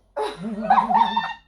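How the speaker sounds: high delighted laugh. Real, not acted. Remembering her earnings jump, high delighted laugh. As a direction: laugh out the joy.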